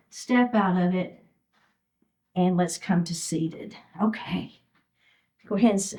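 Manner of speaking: winded after the exercise; out of breath. Real, not acted. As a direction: speak short of breath, between breaths. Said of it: "I'm a little out of breath".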